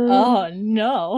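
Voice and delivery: dismayed gasp. Real, not acted. Reacting to the red coat.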